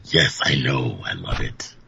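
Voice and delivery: very low, soft demonic rumble; devil voice. Shown as a demonstration. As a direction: growl like a demon from below. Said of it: "The devil voice is awesome".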